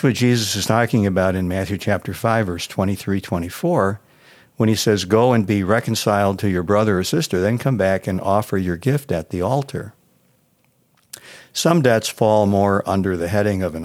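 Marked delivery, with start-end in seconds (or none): none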